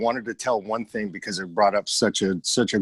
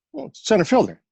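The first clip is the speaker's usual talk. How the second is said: put-on much higher boyish voice answering; young me; 12 semitones higher, 3 dB louder than usual. Ten-year-old answering his dad's quiz, high kid voice. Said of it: "ten, eleven years old".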